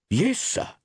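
high-pitched startled reply. A narrator's reading. Landlord caught out by a guest's question, startled. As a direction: answer as if caught off guard, pitch jumping. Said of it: "rather startled".

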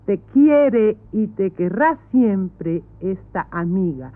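Spanish words said with Spanish sounds: "Te quiere y te querrá siempre esta amiga"; quoting in Spanish. Shown as a demonstration. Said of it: "I say in Spanish".

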